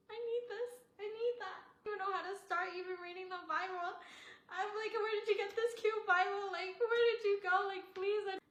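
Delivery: high, loud eager gushing; excited gushing. Retold or imitated. Begging her friend for the cute Bible, high eager gushing. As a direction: gush over it like a thrilled fan.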